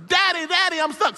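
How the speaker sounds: stuck son pleading with father; child calling. Retold or imitated. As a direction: cry for daddy again. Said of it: "stuck kid calls again".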